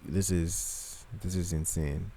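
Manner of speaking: quiet, awestruck murmur; hushed amazement at a clip; awed hush. Retold or imitated. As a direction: breathe it out in awe. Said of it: "I was like".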